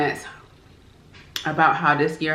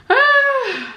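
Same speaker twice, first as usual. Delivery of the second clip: long, high weary sigh; heavy sigh; 4 dB louder than usual. Real, not acted. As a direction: let out all the frustration. Weary sigh at the shutdown news.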